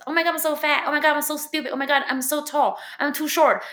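hammed-up insecure girl; mock self-hating girl. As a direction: parody a girl putting herself down. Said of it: "People want us to hate ourselves".

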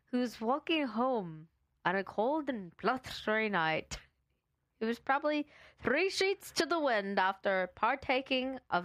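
Irish lilt that comes and goes; on-and-off Irish accent. Acted. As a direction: try for Irish storytelling, losing it. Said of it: "going in and out of accent".

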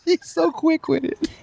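high, breathless praise; after laughing hard. Real, not acted. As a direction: still catching breath from laughing. Talking right after laughing.

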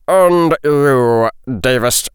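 deliberate, menacing order; controlled, angry teacher's command; stern potions master. A narrator's reading. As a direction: pause between words with cold authority.

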